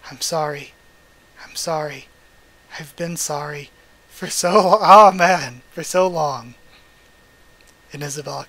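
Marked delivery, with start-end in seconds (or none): 0.1-6.5 real crying. Real, not acted. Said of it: "could have me crying in a video".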